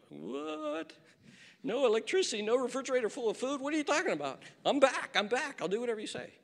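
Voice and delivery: high, mock panicked teenager; whiny teen caving. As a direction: ham up the boy begging to come back.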